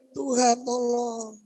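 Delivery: high, long-drawn mock plea; rengekan seperti bayi. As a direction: whine it slowly like a helpless baby. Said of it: "Seperti bayi. Nangis". (merengek panjang bernada tinggi seperti bayi)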